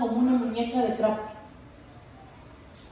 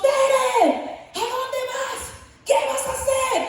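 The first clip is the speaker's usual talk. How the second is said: calling out; 10 semitones higher, slower than usual. Retold or imitated. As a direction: shout her name to stop her. Friend calls out to running mother.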